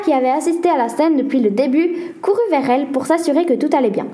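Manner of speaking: plain reading voice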